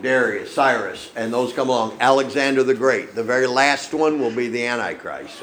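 ordinary talk

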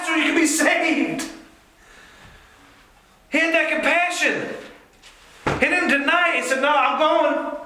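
high, impassioned preacher cry; preaching at full force. Acted. Preacher urges the church about Jesus' compassion, fervent and high. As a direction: lift the whole room with fervor.

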